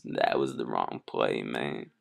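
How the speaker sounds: very low rapper voice; [name] voice. Pretend. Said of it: "like [name]".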